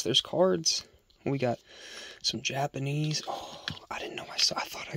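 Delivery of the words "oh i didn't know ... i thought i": whispering. Real, not acted. Surprised at finding cards, unvoiced.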